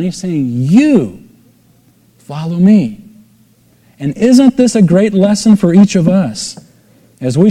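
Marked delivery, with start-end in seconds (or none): 0.5-3.0 You set apart, a pause, then follow me falling; stress on You. Shown as a demonstration. Preacher re-voicing Jesus to Peter, emphatic You. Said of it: "is emphatic".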